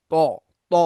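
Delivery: "ত" said thick and rounded, like "to"; heavy, thick pronunciation. Shown as a demonstration. Teacher correcting students' "তা", thick rounded "ত". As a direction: make "ত" a full, heavy sound, never thin "ta". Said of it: "মোটা করে উচ্চারণ করতে হবে".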